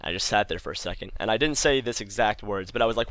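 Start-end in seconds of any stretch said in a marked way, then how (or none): none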